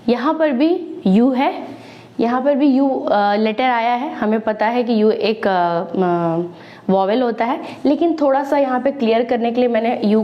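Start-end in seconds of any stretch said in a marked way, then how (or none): none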